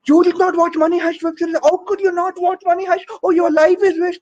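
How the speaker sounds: high, shocked fan voice. Retold or imitated. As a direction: gasp in mock disbelief as a pushy fan. Friend shocked about Money Heist.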